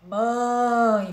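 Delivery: "Mãe" stretched out through the nose; nasal vowel. Shown as a demonstration. Teacher asks pupils to repeat, nasalised "Mãe". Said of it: "De novo".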